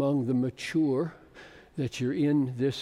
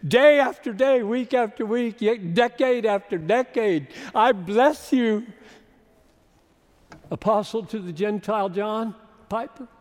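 fervent blessing; 7 semitones higher, 6 dB louder than usual. Real, not acted.

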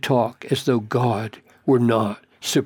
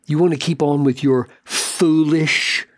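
usual speaking voice

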